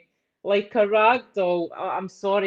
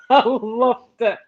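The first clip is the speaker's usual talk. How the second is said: laughing; 3 semitones higher, slower than usual. Real, not acted. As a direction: gush it through a real laugh. Caller delighted, cracking up mid-sentence.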